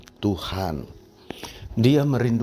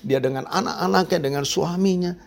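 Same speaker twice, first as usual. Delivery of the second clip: a high-pitched, fretful woman's voice, lightly voiced; a lightly voiced woman fretting over what comes next; worried woman; 7 semitones higher, 3 dB louder than usual.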